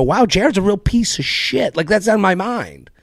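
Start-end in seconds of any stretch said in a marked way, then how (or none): 0.0-1.7 imitating audience member. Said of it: "go back and go".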